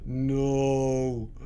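low, drawn-out NO; low dismayed no. Real, not acted. Player facing defeat, low drawn-out NO. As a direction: let NO sink low and long. Refusing to accept the loss.

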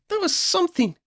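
very high-pitched amazement; high, impressed young voice; amazed. A narrator's reading. Friend amazed by the spell.

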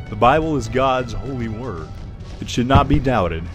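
normal talking voice

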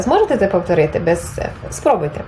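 ordinary talk, nothing marked

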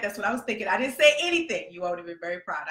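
ordinary delivery

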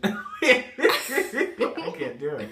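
bursting into laughter; real laugh mid-word. Real, not acted. Breaking up laughing.

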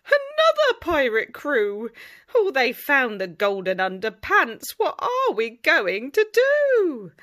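high, loud alarm; panicked. Acted. Pirates panicking over the stolen golden pants. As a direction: cry it in a panic.

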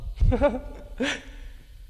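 nervous laugh. Real, not acted. Shy laugh introducing band.

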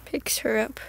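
whispered aside; whispered narration of own action. Real, not acted. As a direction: breathe it out without voice.

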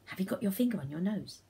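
usual speaking voice

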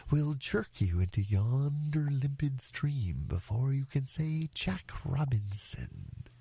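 slow, ponderous rock snake voice; pompous python. Acted. Python advises the elephant's child, slow and pompous. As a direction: draw out each phrase with great formality. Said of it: "the way bicolored python rock snakes always talk".

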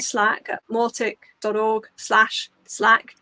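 spelled out slowly, word by word; slow and careful. Real, not acted. Giving listeners a tongue-twisting web address, slowly. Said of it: "I have to say that slowly".

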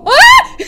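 sudden high yelp then a crying gasp; real scream of fright; startled scream. Real, not acted. Jump scare in horror game.

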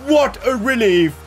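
loud, high, jubilant outburst; ecstatic goal call. Real, not acted. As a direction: shout the last-minute winner with relief. Winning goal in last minute.

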